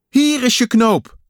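high, loud excited call; excited find. A narrator's reading. Kikker finds a button in the mud, excited high call. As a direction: sing out the discovery, high and loud.

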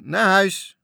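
high, loud joyful cry; joyful shout. Acted. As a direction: cheer like sailors set free. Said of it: "riepen ze blij". (matrozen roepen blij naar de jongens)

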